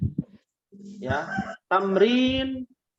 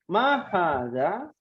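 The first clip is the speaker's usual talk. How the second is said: slow drill question; much slower than usual. Shown as a demonstration. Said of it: "Diulangi ya".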